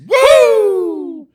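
high loud winded whoop; mock exhausted woo. Joking about being winded after a short walk, loud whoop. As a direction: whoop like a block wore you out.

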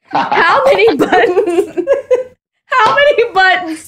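slow incredulous question dissolving into giggles; laughing question. Real, not acted. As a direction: crack up while trying to ask. Cracking up while asking silly question.

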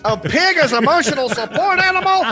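high-pitched incredulous Karen; mock freak-out. Host imitates Karen hearing of the support animal, freaking out. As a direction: squeal it in hammed-up disbelief. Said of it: "That's when the Karen freaks out again".

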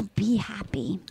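low, soft whisper; hushed imitation of a parting line; mock whispered farewell. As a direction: whisper it like a solemn goodbye. Quoting his parting words.

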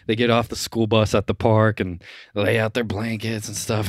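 usual speaking voice

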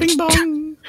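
high sung two-note ringtone; mock phone ring. Player mimicking a phone ringing with a high two-note chime.

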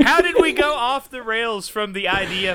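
high, real laughing protest; laughing exclamation. Real, not acted. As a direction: exclaim helplessly through laughter. Cracking up at accent game.

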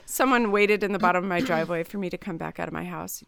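ordinary talk

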